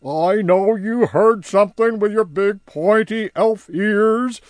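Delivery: high, loud coaxing creature voice; High pressing Barnaby for gossip, loud and high; High, pushy. Acted. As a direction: wheedle the secret out of him. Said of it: "said High".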